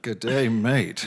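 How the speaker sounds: slow, drawn-out Aussie greeting; playful Australian impression; Australian accent. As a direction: stretch it out in mock Australian. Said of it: "She had an Australian accent".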